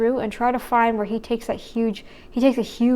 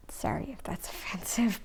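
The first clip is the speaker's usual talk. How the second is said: laughing whisper; whispered aside; 3 semitones lower, 12 dB quieter than usual. Real, not acted. Apologizing to viewers for the mock accent, in a laughing whisper. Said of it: "whispered aside".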